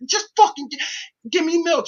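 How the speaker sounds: high, fed-up plea for plain milk; exasperated demand. Retold or imitated. Customer at the coffee counter, exasperated demand. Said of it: "I'm like".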